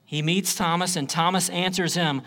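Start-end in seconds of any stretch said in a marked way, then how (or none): none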